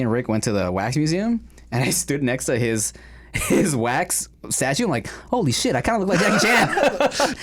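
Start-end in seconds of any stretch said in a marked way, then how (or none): 5.3-7.4 high-pitched mock-shocked outburst; amused, high-pitched disbelief; startled realisation. Retells his startled realisation.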